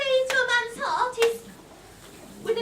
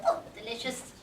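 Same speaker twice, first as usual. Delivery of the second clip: much lower sly crone; low witch voice; 12 semitones lower, 3 dB quieter than usual. Acted.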